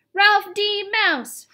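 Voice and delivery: high, loud taunting yell. A narrator's reading. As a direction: yell the name out across the room. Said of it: "Brad yelled".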